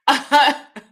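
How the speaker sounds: high, loud laugh out of amusement; burst of laughter. Real, not acted. Amused by the copycat trial looks.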